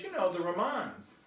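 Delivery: high, loaded imitation of the foreign guide; exasperated guide with a mock German accent; German tour guide accent. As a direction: mangle REMAINS into "Romans" in her accent. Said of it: "And she goes".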